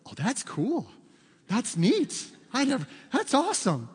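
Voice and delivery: mock amazement with swooping pitch; impressed stepdad. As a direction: imitate a tech novice marvelling. Stepdad impressed by GPS, acted out.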